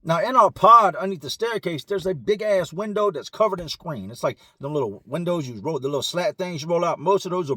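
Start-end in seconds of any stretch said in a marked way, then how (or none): none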